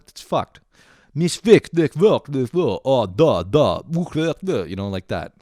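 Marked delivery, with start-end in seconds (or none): 1.1-3.8 mock Russian gibberish, punched out loud; Russian impression. Comedian mocking a train passenger's language, crude Russian impression. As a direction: spit out fake Russian nonsense syllables. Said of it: "my fucking Russian impression".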